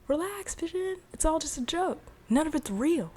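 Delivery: high-pitched breezy brush-off; acted-out, high "relax, it's nothing" tone; dismissive reassurance. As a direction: play down the worry in a high voice. Norm brushing off Vision's worry.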